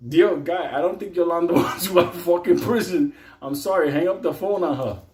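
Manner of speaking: begging him to hang up, half laughing; exasperated mock plea. Comic telling the guy to quit calling Yolanda, exasperated. Mocking inmate on phone.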